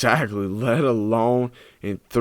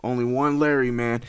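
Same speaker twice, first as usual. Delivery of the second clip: ordinary talk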